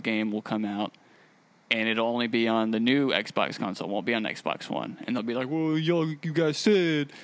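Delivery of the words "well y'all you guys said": higher, whiny internet troll voice; Twitter troll voice. Acted. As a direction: play an indignant online troll, pitched up. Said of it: "my Twitter troll voice".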